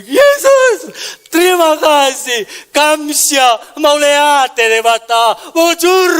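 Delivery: high, loud cry of thanks; loud shout. Acted. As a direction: shout praise slowly at full voice, as the healed leper. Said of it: "dia teriak dengan suara nyaring".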